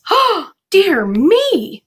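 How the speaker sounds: high, slow, shocked "oh dear me"; a mother's startled cry of dismay; dismayed gasp. Acted. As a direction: gasp it out in alarm. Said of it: "Ma said".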